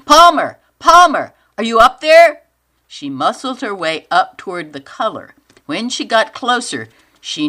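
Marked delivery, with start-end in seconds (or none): 0.1-2.4 loud searching call. Acted. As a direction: shout up the slope. Calling out in the fog.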